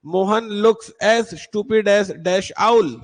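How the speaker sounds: very slow, each word apart; slow dictation. Shown as a demonstration. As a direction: dictate it slowly to students. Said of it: "teacher reads exercise slowly". (धीमे-धीमे पढ़कर बोलना)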